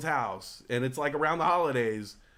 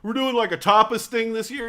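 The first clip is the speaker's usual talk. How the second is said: louder, breezy host voice; host's casual announcement; 6 dB louder than usual. Retold or imitated. Host telling guests about the tapas dinner, breezy and louder. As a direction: tell it like a host apologising lightly. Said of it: "they're like".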